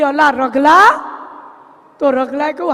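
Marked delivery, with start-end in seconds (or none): 0.0-0.8 loud call toward the kitchen. Acted. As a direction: shout the servant's name from another room. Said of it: "ત્યાં એણે બૂમ મારી". (રસોડા તરફ નોકરને બૂમ)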